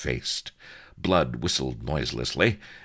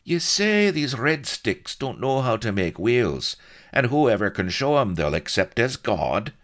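raised, loud dialect voice; challenging Colonel Blood; 10 semitones higher, 5 dB louder than usual. A narrator's reading. Blood questions Solomon about the wheels, challenging voice. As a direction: challenge the old man's story.

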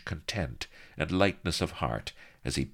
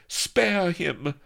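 high, loud lady's plea; the lady begging for the hero's life; pleading cry; 4 dB louder than usual. Acted. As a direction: cry out to stop the fight. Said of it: "cried Vanity".

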